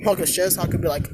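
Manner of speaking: ordinary delivery, nothing marked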